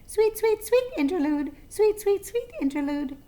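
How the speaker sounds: high, sing-song bird call; song sparrow's song. A narrator's reading. Narrator voicing the song sparrow's sweet song, high. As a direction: lilt it like a songbird.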